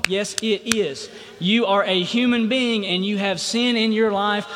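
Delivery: ordinary talk, nothing marked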